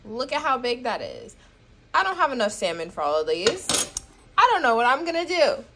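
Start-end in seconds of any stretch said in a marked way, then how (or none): none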